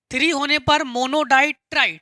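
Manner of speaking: ordinary delivery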